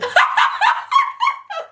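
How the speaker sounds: shrill burst of laughter; high shrieking laugh. Real, not acted. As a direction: break into a high cackle. Mom laughing at her joke.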